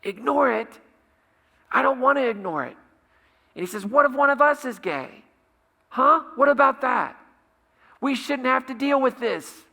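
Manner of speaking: shouted high and loud, in rage; a teammate's advice thrown back in fury, screamed; retold scream. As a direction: hurl it back as an enraged outburst. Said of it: "he just screams out".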